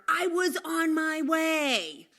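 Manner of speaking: put-on slow, high, loud kid's whine; mock teenage shout of protest; whiny kid shout. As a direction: drag it out like a bratty kid yelling back. Acted kid talking back.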